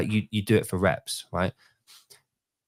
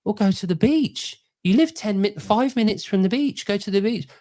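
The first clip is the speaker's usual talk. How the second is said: higher, pushy mock advice; exasperated retort; 8 semitones higher than usual. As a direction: tell her off with exasperation. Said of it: "I'm like".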